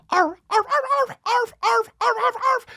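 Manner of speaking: very high squeaky arfs; squeaky puppy arf; high cartoon barking. Imitating cartoon dog video.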